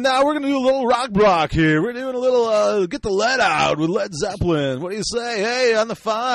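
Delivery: hyped-up morning DJ, high and loud; radio DJ. Acted. Host mimicking a radio DJ announcing a rock block, high loud hype. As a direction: push it like a wacky rock-station jock. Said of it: "you'd go from radio, go".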